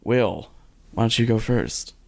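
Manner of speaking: ordinary delivery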